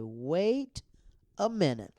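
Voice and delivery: loud, high wait a minute; surprised. Retold or imitated. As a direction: stop everything, amazed. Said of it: "I said".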